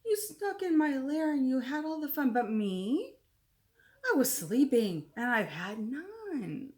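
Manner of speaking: a sulky bear telling them off; grumpy bear complaint. A narrator's reading. Angry bear scolds guests.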